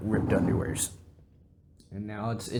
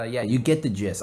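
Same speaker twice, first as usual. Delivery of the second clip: ordinary talk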